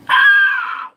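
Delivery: very high loud scream; full yelp of pain. Retold or imitated. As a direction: shriek as the horse bites.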